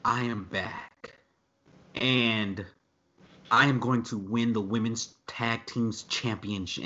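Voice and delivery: slow, soft and stiff, like reciting a script; mock her wooden, over-deliberate promo voice; mock Lana. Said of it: "it started off with Lana like".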